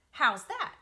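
loud proud question; triumphant loud rat question. Acted. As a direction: show off the prize. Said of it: "he said triumphantly".

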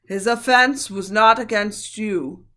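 loud, slow lion voice; measured and commanding, slowly. A narrator's reading. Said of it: "said Aslan".

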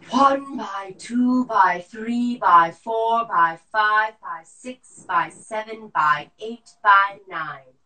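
slow loud forced count; lung function test. Shown as a demonstration. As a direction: push the breath out counting. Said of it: "breath count drill".

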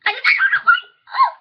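laughing, squealing very high; hyper. Real, not acted. Girl apologises to viewers for being hyper, laughing squeal. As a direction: let the giddiness burst into a laughing shriek. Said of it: "I'm so, um, really hyper".

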